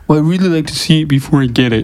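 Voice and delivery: mock the customer in a puppet-frog voice; Kermit the Frog. Tattooist imitates a client on the table, froggy puppet voice. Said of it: "they don't sound like Kermit the Frog".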